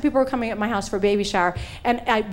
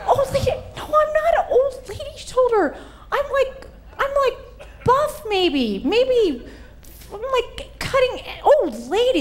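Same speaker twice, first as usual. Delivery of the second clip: outraged protest; 13 semitones higher, slower than usual. Retold or imitated. Told she has old lady shoulder.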